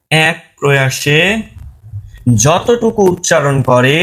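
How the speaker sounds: very slow, word by word, long pauses; slow dictation. Shown as a demonstration. Teacher giving students a definition to memorise, slow dictation. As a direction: dictate it piece by piece for note-takers.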